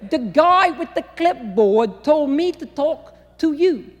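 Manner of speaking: put-on slow, loud, carefully enunciated New York accent; slow enunciated Northern accent. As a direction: explain it slowly to the slow Southerner. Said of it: "She slowed down and enunciated".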